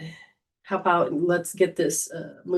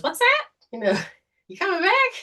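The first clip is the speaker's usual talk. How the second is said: much higher squawky parrot imitation, fast at the end; cockatoo voice; 13 semitones higher than usual. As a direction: play the talking cockatoo calling the dog. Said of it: "And Charlie goes".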